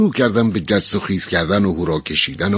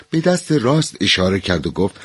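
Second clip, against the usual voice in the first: ordinary delivery, nothing marked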